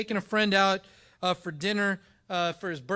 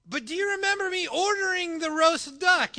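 higher and louder, pressing the point; exasperated demand; 9 semitones higher, 5 dB louder than usual. Retold or imitated. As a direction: corner the waiter with it. Arguing with waiter over bill.

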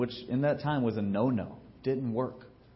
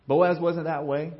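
ordinary talk

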